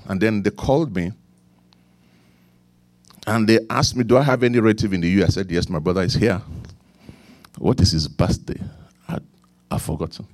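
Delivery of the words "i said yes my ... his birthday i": usual speaking voice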